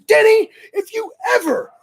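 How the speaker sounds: urgent retold yell, pitched high; a hammed-up angry command to get inside; lost my mind. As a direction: bark orders at him, frantic. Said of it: "I lost my mind".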